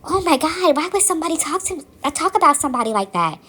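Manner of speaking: high, fast, scandalized gasp of a friend; shocked friend. Retold or imitated. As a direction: rush it out like you can't believe the gossip. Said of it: "And she's like".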